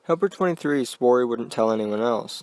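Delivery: usual speaking voice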